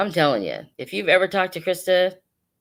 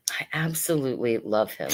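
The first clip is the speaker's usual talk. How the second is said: whisper; 3 semitones lower, 3 dB quieter than usual. Real, not acted. Confiding affection about a hat pin.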